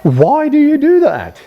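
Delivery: very high, drawn-out wail of protest; hammed-up disbelief, squeaking slowly upward; exasperated CEO. CEO protesting to the security guard, exasperated. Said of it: "that's what the C E O says".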